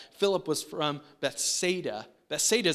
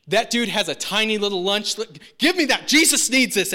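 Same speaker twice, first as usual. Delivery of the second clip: loud, much higher, bossy snatching; hammed-up bully taking a lunch; mock grabby demand; 5 semitones higher, 9 dB louder than usual. Andrew imagined snatching a kid's lunch, hammed-up loud demand. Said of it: "Andrew would have been like".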